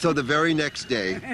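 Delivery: ordinary delivery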